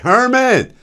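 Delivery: a loud, high taunting call of the name; mocking call. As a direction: yell it across the schoolyard like a bully. Kids taunting him by name.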